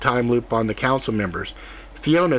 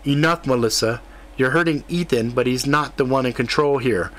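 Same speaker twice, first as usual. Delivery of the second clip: ordinary delivery, nothing marked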